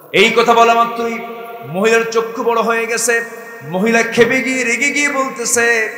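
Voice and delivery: loud, high preaching build; storytelling preacher at full volume; fiery sermon narration. Acted.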